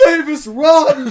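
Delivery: high, loud, slow laughing cry of warning; panicked Henry. Acted. Henry shouting at Mavis to flee, high and loud with laughter. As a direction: yell a frantic warning through laughter. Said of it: "Henry's just like".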